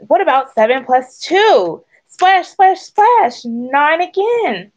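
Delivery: high, bouncy, lively cries; excited quacking. A narrator's reading. Thistle splashes while doing sums, excited quacking. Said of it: "Thistle quacked".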